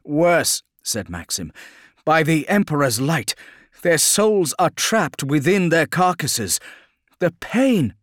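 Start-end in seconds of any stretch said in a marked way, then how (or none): 0.0-0.6 grim battle reply. Acted. Grim correction amid battle. 2.0-7.9 high, raised voice of anguish; appalled, pitched up and intense; horrified outcry. Acted. Horrified outcry at trapped souls in battle.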